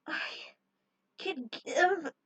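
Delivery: strained, breaking words; exhausted gasp. Acted. Heroine collapsing after her attack, strained exhausted cry. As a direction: push the words out spent.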